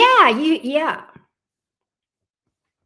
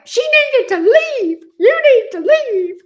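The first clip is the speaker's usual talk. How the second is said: shrill, slow mocking voice, much higher and louder; 13 semitones higher, 8 dB louder, slower than usual. Mocking a rude chatter in a shrill squeal. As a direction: parrot her in a piercing high squeal. Mock shrill demand, very high.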